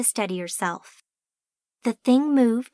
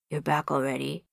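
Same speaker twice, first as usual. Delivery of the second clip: deep gargoyle voice; 8 semitones lower, 4 dB quieter than usual. A narrator's reading. Gargoyle greeting them.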